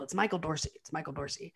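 hushed, falling away to very quiet; dropped voice. Retold or imitated. Dorothy finally lets him know, voice dropped low and quiet. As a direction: imitate dropping into a low, confidential murmur. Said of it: "when he drops his voice".

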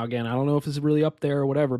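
ordinary delivery

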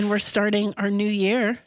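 ordinary talk

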